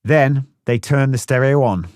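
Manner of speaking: ordinary delivery, nothing marked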